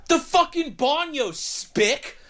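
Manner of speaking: put-on high, snarling old man outburst; grizzled old man yelling. Grizzled old man yelling at the cook, high and furious. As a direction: spit it out in a high furious rasp.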